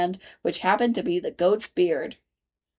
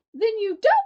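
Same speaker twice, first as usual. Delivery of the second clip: much higher gnat voice; tiny voice; 13 semitones higher, 3 dB louder than usual. A narrator's reading. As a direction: squeak it as the gnat. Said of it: "the little voice began".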